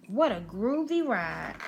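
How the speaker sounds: ordinary delivery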